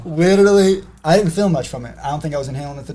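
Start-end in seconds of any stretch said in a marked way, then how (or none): 0.1-0.8 emphatic. Real, not acted. Emphatic repeat, laughing.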